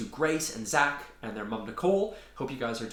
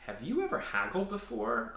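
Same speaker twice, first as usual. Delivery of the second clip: high, disbelieving question; stunned he's been fleeced; incredulous; 9 semitones higher, 3 dB quieter than usual. Acted.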